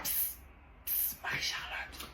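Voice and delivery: slow hushed whisper. Acted. Pupil whispering to a classmate during the exam, hushed. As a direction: call her secretly in a whisper.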